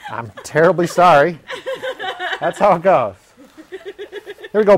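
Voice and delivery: laughing through a forced grin; strained smile, breaking into laughter; faked smile. Shown as a demonstration. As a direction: try to smile naturally and crack up.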